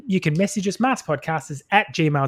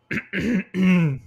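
throat clearing; 4 semitones higher than usual. Real, not acted. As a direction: clear the throat before speaking. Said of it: "he's gonna clear his throat".

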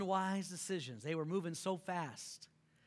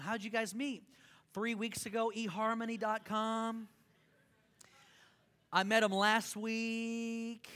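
ordinary talk

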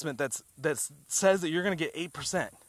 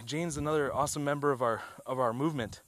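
ordinary talk, nothing marked

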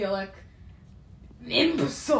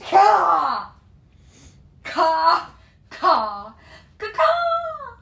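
loud excited chanting yells; shouting; 9 semitones higher, 12 dB louder, much slower than usual. Real, not acted. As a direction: shout it out in bursts.

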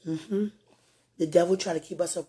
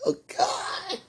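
whispered gasp. Real, not acted. Overwhelmed, breathless exclamation.

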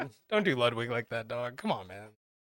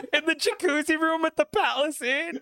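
laughing; 14 semitones higher than usual. Real, not acted. Laughing disbelief at cohost.

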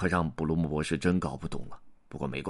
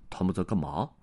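ordinary delivery

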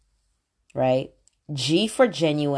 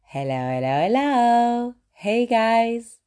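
sing-song hello, last hello jumping high; signature live greeting; 6 semitones higher, slower than usual. Shown as a demonstration. Host starting her videos with a sing-song greeting. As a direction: open the video with the catchphrase, rising. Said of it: "I always start with".

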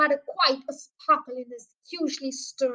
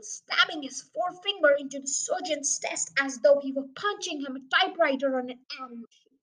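plain reading voice

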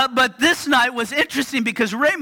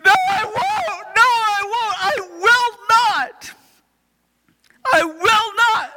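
put-on high, insistent child voice; high, repeated refusal of bed; defiant child; 11 semitones higher than usual. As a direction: dig in like a stubborn kid. Daughter refusing bedtime.

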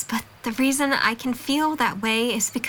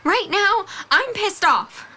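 high, raised-voice snap; heated and emphatic; angry retort; 7 semitones higher, slower than usual. Acted. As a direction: fire back, fed up. Snapping back in quarrel.

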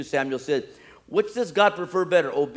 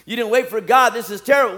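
raised, higher-pitched scolding; loud rebuke; 7 semitones higher, 6 dB louder than usual. Acted. Samuel confronts Saul over not waiting for God, loud rebuke. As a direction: rebuke him at full preaching volume.

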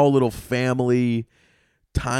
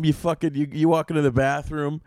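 ordinary talk, nothing marked

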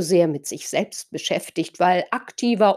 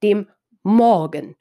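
slow dem Morgen, Morgen stressed and falling; slow stressed Morgen like tomorrow; 4 dB louder than usual. Shown as a demonstration. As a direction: say dem Morgen slowly, weight on Morgen. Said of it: "klingt ... nach der Zeitform".

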